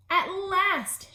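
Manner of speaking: high, loud joyful shout. Acted. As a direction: cheer it out. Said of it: "shouted Toad".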